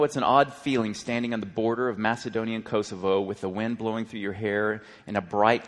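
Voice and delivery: ordinary delivery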